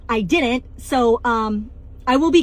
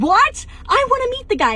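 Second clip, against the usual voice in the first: much higher, eager what; surprised; 10 semitones higher than usual. Retold or imitated. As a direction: perk up, curious and thrilled. Hears crush is at party.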